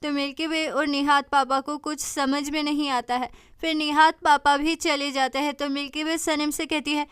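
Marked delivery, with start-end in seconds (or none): none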